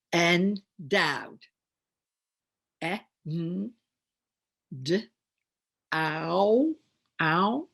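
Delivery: ENDOWED broken into e, n, d, ow; sound by sound. Shown as a demonstration. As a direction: sound out "Endowed" with pauses between phonemes. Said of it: "do a demonstration of higher level reading".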